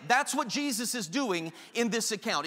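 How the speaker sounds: normal talking voice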